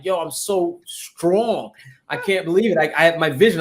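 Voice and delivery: normal talking voice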